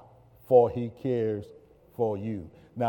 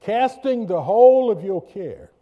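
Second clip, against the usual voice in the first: high, loud preaching read; preached scripture; 9 semitones higher, 11 dB louder than usual. Acted. Pastor reading the verse to church, loud and high. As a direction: proclaim the verse.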